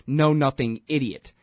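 ordinary delivery

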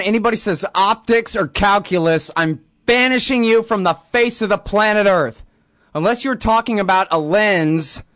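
high-pitched, hammed-up exasperated rant; mock outrage. Host banishing anyone who says optics, mock outrage. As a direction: lay down the law in mock fury.

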